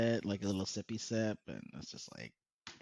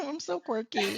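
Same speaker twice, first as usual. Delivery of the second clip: high mock quirky girl; 10 semitones higher than usual. Friend mocking a quirky girl persona, high and cutesy. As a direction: play the quirky girl, sing-song.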